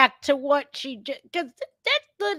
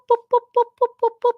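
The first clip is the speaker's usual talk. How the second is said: boop noises; 9 semitones higher, 5 dB louder, much faster than usual. Retold or imitated. Truth bombs raining down.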